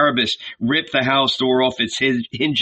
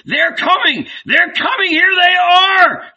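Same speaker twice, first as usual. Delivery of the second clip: much higher, loud alarm cries; alarmed shouts; 6 dB louder than usual. A narrator's reading. Villagers shout a warning from the vineyards, alarmed. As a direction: raise the alarm across the vineyards. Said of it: "cries of".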